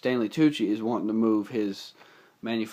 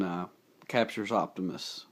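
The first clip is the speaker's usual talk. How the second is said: ordinary talk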